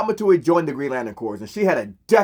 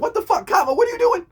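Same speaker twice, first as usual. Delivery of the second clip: much higher, what the fuck Katma; shocked outburst; faster than usual. Retold or imitated. Hal reacts after Katma kisses him, shocked and high. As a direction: squeak out the shock.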